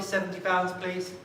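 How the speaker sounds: ordinary delivery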